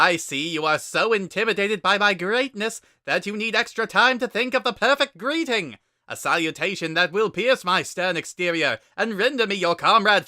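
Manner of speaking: the great Papyrus on the phone. Acted. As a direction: proclaim it grandly. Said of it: "like a kazoo attempting to yodel".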